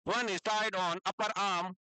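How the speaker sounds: very slow, word by word; slow dictation. Shown as a demonstration. Teacher dictating notes to students, very slow. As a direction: dictate so they can write along. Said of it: "अभी मैं रुक रुक के बोलूंगा".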